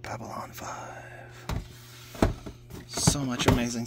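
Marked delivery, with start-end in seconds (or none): 0.0-1.5 whispering. Real, not acted. Reads the same label, whispered.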